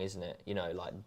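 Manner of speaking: ordinary delivery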